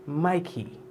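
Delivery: Mikey soft, smooth, falling at the end; said with meaning. Shown as a demonstration. Host teaches how to say Mikey, with meaning. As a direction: linger softly on Mikey with meaning. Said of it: "Say it with meaning".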